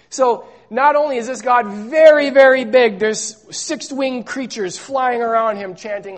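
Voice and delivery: normal talking voice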